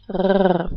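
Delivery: R rasped at the back of the throat; throaty uvular R. Shown as a demonstration. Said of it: "like this".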